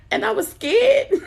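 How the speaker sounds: high loud laugh through the words. Real, not acted. About being alone, laughing out mock fear.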